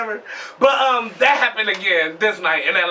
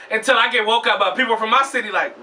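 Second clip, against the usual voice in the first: ordinary talk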